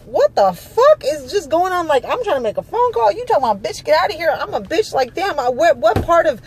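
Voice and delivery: high-pitched, exasperated arguing back; indignant outburst. Retold or imitated. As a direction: act out your outraged comeback to the guard. Acted quarrel with the guard.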